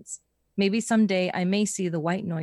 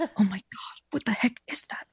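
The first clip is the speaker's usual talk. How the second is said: hushed whisper, calm but alarmed; 3 semitones higher, 3 dB quieter than usual. Shown as a demonstration. Redoing the friend's line as a calm whisper. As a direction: whisper it steadily, alarm underneath. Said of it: "he whispered calmly, but alarmed".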